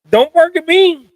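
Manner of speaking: sharp high scolding at the dog; loud scolding. Real, not acted. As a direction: tell the dog off loudly.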